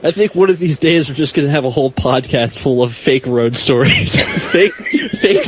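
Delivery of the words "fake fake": laughing. Real, not acted. Laughing repeat.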